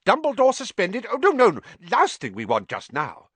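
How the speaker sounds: high, loud, flustered protest; alarmed. A narrator's reading. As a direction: recoil from the idea in alarm. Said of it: "looking alarmed".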